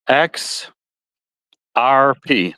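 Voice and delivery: X R P said with a Midwestern twang, letters drawn out; slight Midwestern twang on the letters. Real, not acted. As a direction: let the Midwestern twang color "X R P". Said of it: "That's my Midwestern twang".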